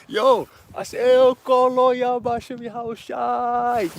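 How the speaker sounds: loud, high, drawn-out praise cry; screaming. Retold or imitated. Praising in the car over brother's job, screaming. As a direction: holler the name slowly and jubilantly.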